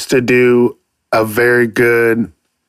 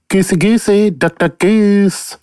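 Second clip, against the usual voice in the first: high cartoon voice; 8 semitones higher than usual. Playing voices, cartoon game chant.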